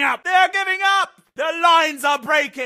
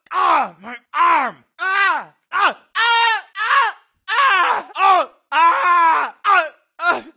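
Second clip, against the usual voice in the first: pained cries; slower than usual. Acted. Soldier wounded in battle.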